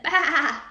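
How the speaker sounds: loud bleating baa; sheep baa. Acted. Teacher reading to class, a loud sheep baa. Teacher reads animal sounds.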